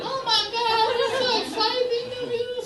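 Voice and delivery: put-on high, excited Colombian accent; Colombian parents. Colombian parents greeting their son, high and excited. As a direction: gush like a thrilled Colombian mom. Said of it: "they're Colombian".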